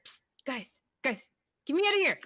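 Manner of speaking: hissed psst, then fast, loud pleading; urgent cry for help from inside; trapped in a bottle. Acted. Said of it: "You hear".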